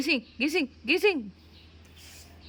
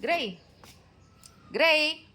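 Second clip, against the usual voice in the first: high, loud call of the name; loud, higher call to a pet; 7 semitones higher, 3 dB louder, much slower than usual. Real, not acted. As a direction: call him to come jogging. Calling pet to come.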